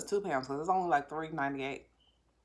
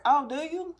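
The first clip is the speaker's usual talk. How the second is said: high sarcastic disbelief; skeptical, high and mocking; sarcastic doubt; 11 semitones higher, 7 dB louder, slower than usual. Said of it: "they're like".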